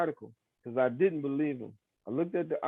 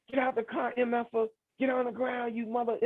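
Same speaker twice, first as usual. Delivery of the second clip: imitated police yelling; 10 semitones higher than usual. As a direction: throw out the cops' orders in a high mock yell. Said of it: "They screaming and yelling".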